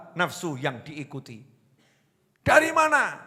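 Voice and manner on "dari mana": "Dari mana?" asked much higher; wife demands where he's been; high question. Retold or imitated. Wife asks again, high. (istri bertanya dengan nada tinggi)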